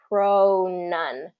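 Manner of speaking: drawn-out syllables, evenly repeated; slow pronunciation drill; slow mouth training. Shown as a demonstration. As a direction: practise the move between syllables slowly. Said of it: "train your mouth slowly".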